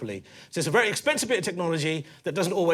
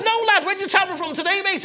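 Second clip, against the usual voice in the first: high, loud Scouse cabbie; overexcited Scouse greeting, pitched way up; Scouse taxi driver; 13 semitones higher, 5 dB louder than usual. Acted. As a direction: play a hammed-up Scouse driver. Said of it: "this Scouse taxi driver".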